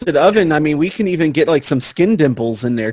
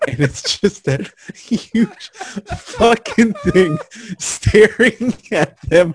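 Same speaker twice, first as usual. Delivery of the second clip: words broken by laughter; laughing through the line; 6 semitones higher, much slower than usual. Real, not acted. Laughing while describing oven.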